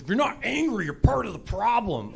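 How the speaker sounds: high-pitched mock outrage; mock angry. Social media users scolding whoever isn't angry, mock angry. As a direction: imitate an angry online scold. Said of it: "I know everybody's angry now".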